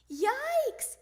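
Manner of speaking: high, loud yelp; yelling. Acted. Froggy stung by Dad's aftershave, high yell. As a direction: yelp at the sudden sting. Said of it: "he yelled".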